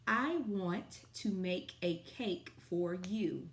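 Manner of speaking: slow, clear dictation pace. Shown as a demonstration. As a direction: read each word slowly for kids. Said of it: "Our sight word sentence of the day".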